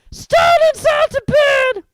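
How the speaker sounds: very high, loud, slow bellow; raucous sprite raising the alarm; bellowing poltergeist. A narrator's reading. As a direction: bellow it down the corridor. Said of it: "Peeves bellowed".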